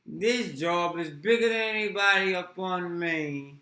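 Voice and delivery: slow, loud hammed-up Cockney; mock Cockney. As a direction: lean hard into the Cockney. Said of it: "Say it again".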